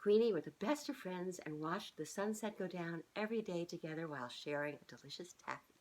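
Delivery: plain reading voice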